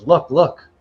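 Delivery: high, loud, insistent character voice; excited urging. Acted. She goes to the next picture, excitedly urging. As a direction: urge someone to look, pitched right up.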